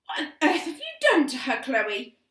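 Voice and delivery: loud, high accusing demand; demanding mother. A narrator's reading. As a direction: insist on an answer. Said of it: "demanded Mother".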